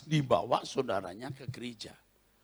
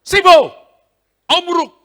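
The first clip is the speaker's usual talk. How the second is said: loud, high shout of a foreign command; preacher yelling a rebuke at the demon; 20 dB louder than usual. Retold or imitated. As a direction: blast the words at the spirit. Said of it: "Saya bilang".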